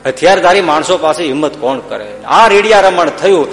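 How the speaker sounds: ordinary delivery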